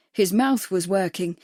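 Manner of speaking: plain reading voice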